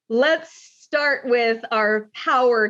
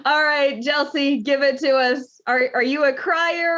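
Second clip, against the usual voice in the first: ordinary talk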